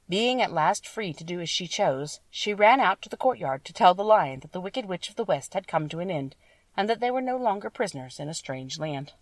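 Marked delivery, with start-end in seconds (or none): none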